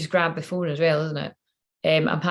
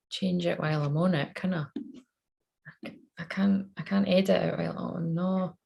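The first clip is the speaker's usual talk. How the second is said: ordinary talk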